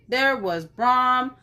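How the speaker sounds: ordinary delivery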